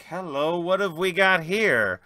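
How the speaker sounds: intrigued pilot. Acted. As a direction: lean in, delighted by the prize. Mick spots the flashy ship.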